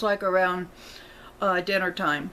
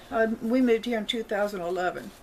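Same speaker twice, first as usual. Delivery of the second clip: ordinary delivery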